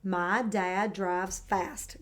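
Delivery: slow and deliberate, word by word; slow model sentence. Shown as a demonstration. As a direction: spell it out slowly so it can be copied. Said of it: "Add fast after drives".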